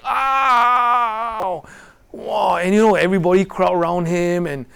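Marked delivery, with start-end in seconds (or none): 0.0-1.6 high, loud mock "ow" cry; imitated yelp of pain. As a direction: overdo a whiny pain yelp. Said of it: "And then he started shouting".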